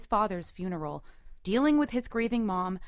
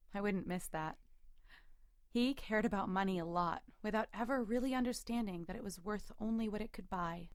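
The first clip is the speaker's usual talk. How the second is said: subdued reflection; 9 dB quieter than usual. A narrator's reading. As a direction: confide it quietly, as private thought. Narrator reflects after his outburst.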